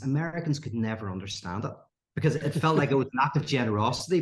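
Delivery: normal talking voice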